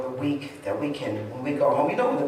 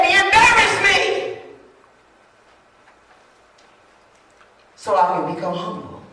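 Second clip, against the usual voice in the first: pleading prayer; 9 semitones higher, 7 dB louder than usual. Acted. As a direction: plead with God until you cry out. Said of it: "ask God".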